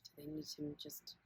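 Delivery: unhurried meditation guide, long pauses between phrases; guided body scan. Acted. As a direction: guide listeners gently inward, leaving space after each question.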